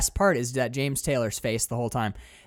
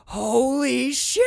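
slow, drawn-out stunned exclamation, higher; amazed holy shit; 10 semitones higher, 4 dB louder, much slower than usual. Retold or imitated. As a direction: stretch it in awe. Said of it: "And he just screams".

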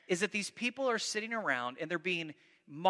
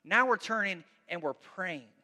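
ordinary delivery

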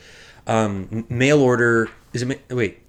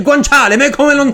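outraged Italian grandma; 8 dB louder than usual. Retold or imitated. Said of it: "she'll be like".

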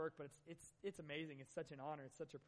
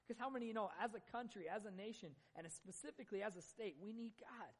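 rising, higher preacher's plea; building preaching; 5 semitones higher than usual. Acted. Guest pastor urging the church to need God, building preaching. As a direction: build the call from high to higher. Closing call in a sermon.

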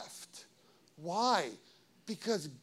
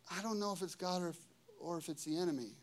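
hesitant doubt; 9 dB quieter, much faster than usual. Acted. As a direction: murmur it like a doubtful believer. Voices believers' hesitant doubt.

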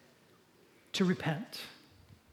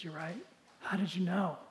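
tearful admission; 2 semitones lower, 10 dB quieter than usual. Acted. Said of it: "began to well up with tears".

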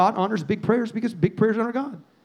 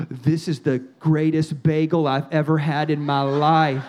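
low, slow, savoring wonder; mock awe; 7 semitones lower, slower than usual. Imitating little son tasting his first donut, awestruck. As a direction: draw it out as if overwhelmed.

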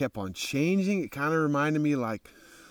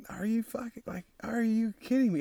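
disbelief; 9 semitones higher, 4 dB quieter than usual. Retold or imitated. Disbelief at free camping.

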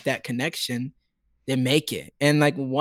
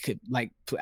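mock halting request; 5 semitones lower, 7 dB quieter than usual. Fan exaggerating the photo request to an idol, halting. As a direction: softly stumble over the words. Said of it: "I didn't say it like that".

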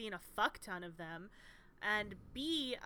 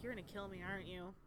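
quiet; 3 semitones lower, 9 dB quieter, much faster than usual. Retold or imitated. Talking to her cat.

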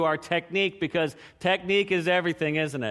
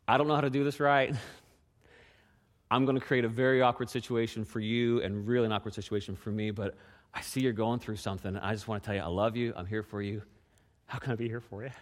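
tender sincere offer; 9 semitones lower, 6 dB quieter than usual. Retold or imitated. Tender quoted offer of help.

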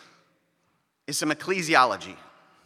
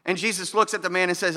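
ordinary talk

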